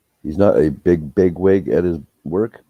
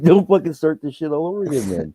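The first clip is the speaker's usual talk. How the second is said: high-pitched mock exasperation with a laugh behind it; retold protest, voice shooting way up; exasperated outburst; 12 semitones higher than usual. Big brother warning the groom to stop, in high mock exasperation.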